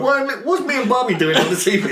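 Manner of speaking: put-on high-pitched baffled old mobster, laughing; high impression breaking into laughter; imitating Junior Soprano. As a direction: do a high, puzzled TV character. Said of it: "and he, he's going".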